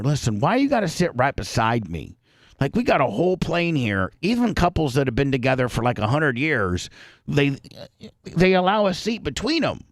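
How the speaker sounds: normal talking voice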